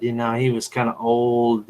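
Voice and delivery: ordinary delivery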